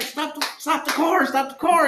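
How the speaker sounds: high, repeated retold command; urgent plea. Man telling drunk driver to stop, urgent. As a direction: act out wanting out right now. Acted panic as she drives.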